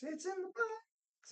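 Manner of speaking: a silly falsetto, toying with the words; squeaky high voice. Pretend. Streamer to chat, sings phrase in a squeaky falsetto. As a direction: squeak the line way up high and slow. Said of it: "silly high take".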